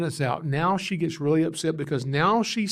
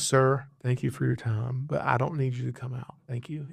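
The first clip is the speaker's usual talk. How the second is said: lowered, soft and composed, a caller who has calmed down; polite and hushed, imitating the calmed-down lady; suddenly very calm; 4 semitones lower, 4 dB quieter than usual. Lady on the phone calling off the snake catcher, quietly calm. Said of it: "she's very calm".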